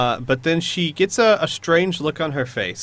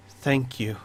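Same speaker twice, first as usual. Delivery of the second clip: soft ghostly thanks; 6 dB quieter than usual. Acted. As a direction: murmur it like a ghost. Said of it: "again you hear".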